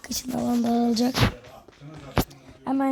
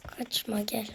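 breathy whisper to the enemy; 3 semitones lower, 9 dB quieter, slower than usual. Real, not acted. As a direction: whisper it under your breath. Luring enemy quietly.

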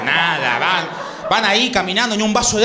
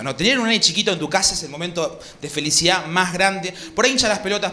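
ordinary delivery

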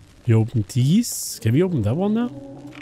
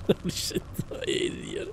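scared whisper; 3 semitones higher, 12 dB quieter than usual. Real, not acted. Scared whisper in dark game.

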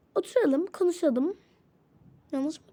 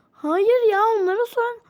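higher and louder, snapping back; angry shout; 6 semitones higher, 6 dB louder than usual. Acted. Woman shouts at the teacher to ask the others, angrily. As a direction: throw it back at her, furious.

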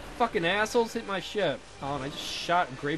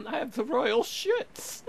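high, drawn-out royal voice; mock queen; 8 semitones higher, much slower than usual. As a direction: play a silly queen, slow and high. Voicing the queen in a joke.

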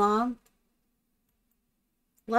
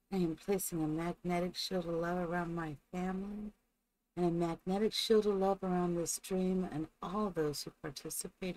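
soft, quiet affirmation read; spoken blessing; 8 dB quieter than usual. Acted. Streamer opens with a soft blessing. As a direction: calmly bless the space and family.

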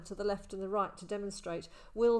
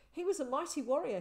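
fast, higher, louder with eager pride; proud boy; 8 semitones higher, 4 dB louder, faster than usual. A narrator's reading. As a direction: boast about his hero father. Boy proudly praising his father.

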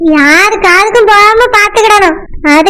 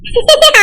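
short laugh; 4 semitones higher than usual. Real, not acted. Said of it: "என்னை பார்த்து சிரிச்சிட்டு இருக்கா".

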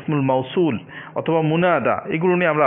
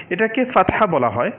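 ordinary talk

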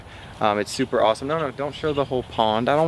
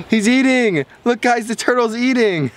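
high, gasping excitement; surprised delight; 10 semitones higher than usual. Real, not acted. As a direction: gasp and point it out. Turtle eats goldfish.

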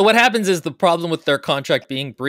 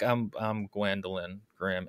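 mumbled mock intro; 9 semitones lower, 13 dB quieter than usual. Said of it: "she's like".

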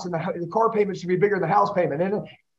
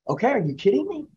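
ordinary talk, nothing marked

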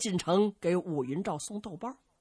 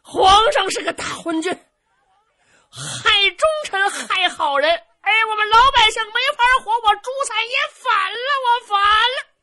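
high, loud, tearful running yell; shouting while running; 13 semitones higher, 10 dB louder than usual. Acted. Zhu Can runs home yelling against the emperor, tearful yell. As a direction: bellow it to the whole street. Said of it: "他一边跑一边喊".

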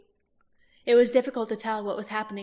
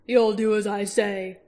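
slow, louder growl; 7 dB louder, slower than usual. A narrator's reading. Roran growling at the Urgle, slow and louder. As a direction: lay down the law. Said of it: "Roran growled".

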